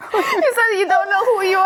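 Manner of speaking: high, laughing repeat; real laughing disbelief. Real, not acted. Friend reacting to her confession to a date, laughing disbelief. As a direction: crack up while echoing a friend's confession.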